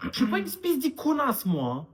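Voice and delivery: offended son snapping back; indignant outburst. Acted. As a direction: bristle at being taken for a fool.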